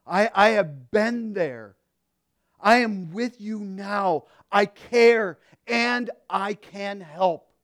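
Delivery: loud, high, impassioned pulpit voice; preaching at full force. Acted. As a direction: preach it out to the congregation. God's promise preached.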